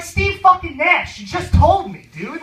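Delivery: loud, high, incredulous yell; acted-out losing patience; retold exasperated outburst. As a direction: blurt out the answer, fed up. Exasperated at repeated question, retold.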